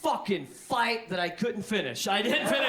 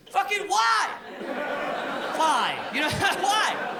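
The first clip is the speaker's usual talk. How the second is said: loud, high incredulous why; exasperated yell; 6 semitones higher, 4 dB louder, much slower than usual. Real, not acted. Comic ranting about a wifi fridge, loud exasperated. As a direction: throw up your hands and shout it.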